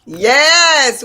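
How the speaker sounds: loud, high burst of a greeting; excited yes. Real, not acted. Host kicking off her radio show, loud and excited. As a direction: open the show with a big shout of joy.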